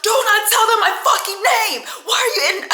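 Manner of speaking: high, loud outrage breaking into laughter; mock pissed-off yell. Man forbids woman to give his name, mock pissed-off yell. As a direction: imitate his furious shriek, amused. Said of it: "he's so fucking pissed".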